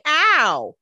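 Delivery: loud, high-pitched "ow"; mock pained yelp. Imitating Elena's bump on the head, mock pained yelp. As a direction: yelp it out, playing hurt. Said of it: "make her be like".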